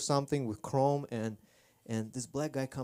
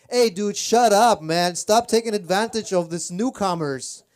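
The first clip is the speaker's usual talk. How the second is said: scolding shout; 7 semitones higher, 11 dB louder than usual. Retold or imitated. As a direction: shout the driver down to protect the newcomer. Bystander scolding the taxi driver.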